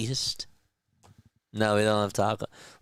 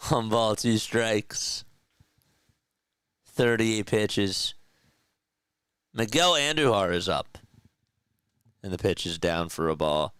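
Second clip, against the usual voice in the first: play-by-play call. Acted. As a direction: call the count like a TV broadcaster. Live play-by-play of his game.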